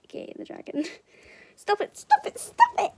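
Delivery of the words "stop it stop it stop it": rising to a high loud shriek; crying out. A narrator's reading. Dragon begging to stop being tickled, rising shriek. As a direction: beg him to stop, louder and higher. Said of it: "he cried".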